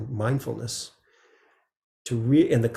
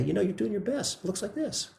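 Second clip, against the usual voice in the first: higher, softer, gentle and kind; tender reassurance with rising lilt; 8 semitones higher, 4 dB quieter than usual. Shown as a demonstration. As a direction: say it like reassuring a friend. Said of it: "It depends on the tone of voice".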